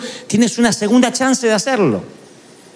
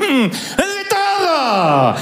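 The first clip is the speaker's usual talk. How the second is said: slow, higher, triumphant "De todo" through laughter; aunt boasting, laughing, of all her illnesses; proud aunt; 7 semitones higher, much slower than usual. Retold or imitated. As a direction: announce it like a trophy. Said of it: "Ese era un trofeo".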